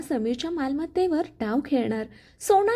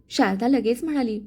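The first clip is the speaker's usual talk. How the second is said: ordinary delivery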